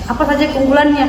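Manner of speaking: ordinary delivery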